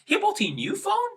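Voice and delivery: questioning tone; confused questioning. Shown as a demonstration. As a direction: ask it in disbelief. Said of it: "confused or questioning tone".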